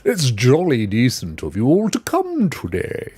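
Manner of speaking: mock posh English host, words stretched out. Streamer welcoming everyone to the show, in a put-on posh voice. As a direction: welcome them in a hammed-up posh accent. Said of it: "Should we do it in a posh voice?".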